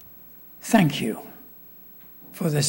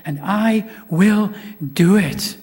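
slow "and I will", then a louder "do it"; emphatic promise; 2 semitones higher, 4 dB louder, slower than usual. Acted. Preacher stressing Christ's promise, slow then loud. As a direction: draw out "will", then land "do it".

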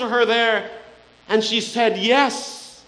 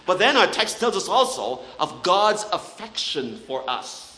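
ordinary talk, nothing marked

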